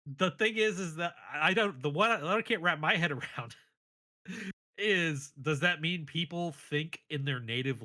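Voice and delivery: ordinary talk, nothing marked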